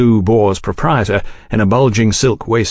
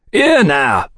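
a raised, higher barman's call; 5 semitones higher than usual. A narrator's reading. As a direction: call out over the bar crowd. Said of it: "cried the barman".

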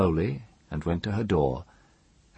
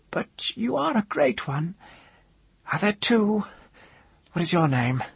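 high, thin voice, less afraid; questioning woman; 13 semitones higher than usual. A narrator's reading. Woman asking the mage who he is, high-pitched. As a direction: probe him in a high voice.

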